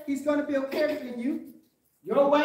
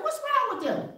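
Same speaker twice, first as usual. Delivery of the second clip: higher, incredulous question; puzzled; 9 semitones higher, 3 dB quieter than usual. Retold or imitated. Preacher voicing his doubt about happy people, incredulous. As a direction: sound baffled by their joy.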